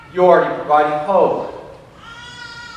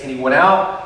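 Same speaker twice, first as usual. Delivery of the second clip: ordinary talk, nothing marked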